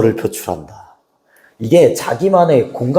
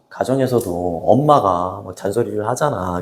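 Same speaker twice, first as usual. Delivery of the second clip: ordinary talk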